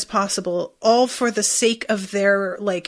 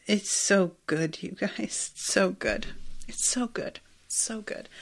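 fading to a whisper; 10 dB quieter than usual. Real, not acted. Savoring the scene, fading away.

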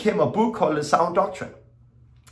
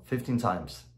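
ordinary talk, nothing marked

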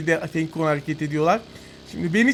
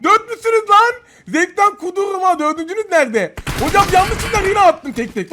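much higher, louder frantic excitement; hyped shout; 10 semitones higher, 5 dB louder than usual. Real, not acted. Gamer shouts at a squad of four, hyped and loud. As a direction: ride the adrenaline of the fight. Fighting four enemies at once.

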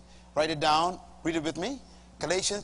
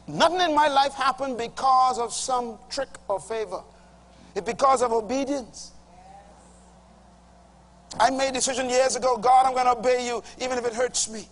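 rising, louder preaching; 7 semitones higher, 4 dB louder than usual. Acted. Preacher testifying to the congregation, building force.

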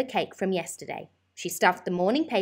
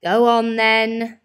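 sighing; 4 semitones higher, 9 dB louder, slower than usual. A narrator's reading. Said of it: "sighed Missus Large".

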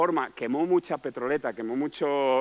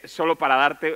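ordinary talk, nothing marked

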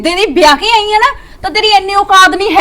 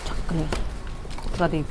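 slow, much softer whisper; whispered aside; 13 semitones lower, 16 dB quieter, much slower than usual. Acted. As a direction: breathe it out under your breath. Wife muttering alone. (ਹੌਲੀ ਫੁਸਫੁਸਾਹਟ)

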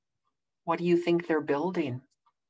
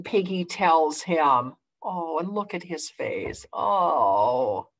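ordinary delivery

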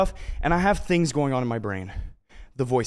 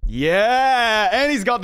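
hyped cheer; 5 semitones higher, 6 dB louder, much slower than usual. Real, not acted. Cheering his guest's entrance.

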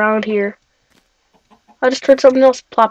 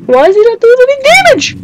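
1 high shout; 12 semitones higher, 6 dB louder than usual. Real, not acted. Panicked gamer yelling at mob.

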